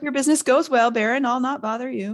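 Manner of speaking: high, eager-to-please farewell; fawning. A narrator's reading. Peeves leaves the Baron to his business, fawning. Peeves fawns while retreating.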